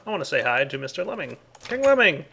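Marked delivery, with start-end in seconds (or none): 1.7-2.2 excited greeting. Real, not acted. Calling out a greeting.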